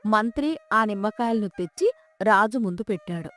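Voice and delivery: usual speaking voice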